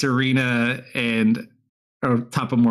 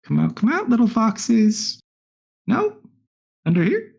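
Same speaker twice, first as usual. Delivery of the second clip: high, singsong coaxing call; playful high-pitched hunter's call; taunting searcher; 11 semitones higher than usual. Acted. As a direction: coax out someone hiding, pitched high. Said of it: "that one hits Tuckin's like".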